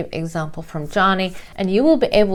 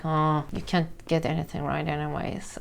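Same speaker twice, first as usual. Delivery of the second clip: muttered low and much softer; passive-aggressive murmur; 5 semitones lower, 10 dB quieter than usual. Retold or imitated. Resentful giver mutters behind your back, soft and low. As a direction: grumble it under your breath, lower. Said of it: "they might murmur".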